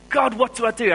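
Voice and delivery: high, loud pleading call to God; retold plea to God, high and loud; desperate cry to God. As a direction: cry out to God, lost and helpless.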